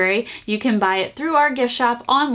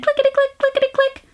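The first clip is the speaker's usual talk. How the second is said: high, tiny clickety-click; squeaky toy-train chant; little train voice; 14 semitones higher, faster than usual. A narrator's reading. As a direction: make it sound like a tiny train.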